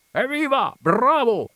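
very high, loud cheer; enthusiastic cheering. A narrator's reading. Coachman cheering the storyteller, enthusiastic shouting. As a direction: cry it out high, carried away. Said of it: "gridava costui entusiasmandosi".

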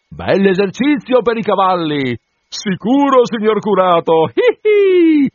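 voce acuta e forte con risatina; assistente che ridacchia. A narrator's reading. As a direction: ridacchia malizioso in sella. Assistente ride galoppando. (assistente malizioso, risatina acuta)

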